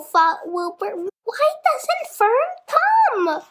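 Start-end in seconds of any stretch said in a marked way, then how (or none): 1.2-3.4 1 crying pig. A narrator's reading. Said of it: "he began to cry".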